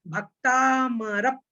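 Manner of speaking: slow भक्ता मरप, joining the प onto र; correct pronunciation. Shown as a demonstration. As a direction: say भक्ता मरप slowly, linking रप. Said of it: "रप बोलना है".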